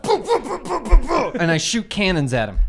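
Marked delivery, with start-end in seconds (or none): 0.0-1.4 high burst of laughter; giddy cackle; laughing. Acted. Player acting cannon blast laugh.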